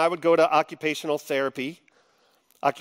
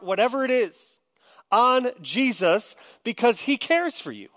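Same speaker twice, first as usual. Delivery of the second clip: normal talking voice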